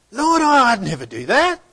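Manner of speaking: high, loud, indignant protest. Acted. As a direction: insist loudly that you would never. Peter objects to Jesus.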